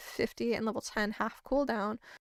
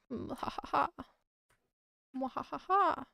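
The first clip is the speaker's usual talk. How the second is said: hammed-up evil cackle; mad scientist laugh; 3 semitones higher, 4 dB quieter than usual. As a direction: mwa ha ha, villain style.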